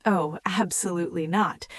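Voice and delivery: usual speaking voice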